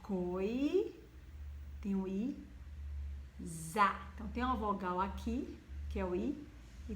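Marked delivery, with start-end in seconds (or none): 0.0-3.9 coi, then za with a buzzing z, slowly; syllable split. Shown as a demonstration. Teacher splitting coisa for pupils. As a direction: break coisa apart and stress za. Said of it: "Olha aqui".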